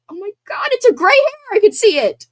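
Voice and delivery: real shock at a gray hair; alarmed surprise. Real, not acted. Spotting a gray hair on camera.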